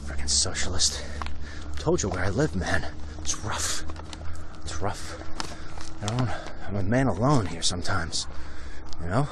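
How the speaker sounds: low, quiet muttering to himself; dejected grumble. Real, not acted. Host muttering about living alone among socialists, a low grumble. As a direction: grumble it under your breath, very low.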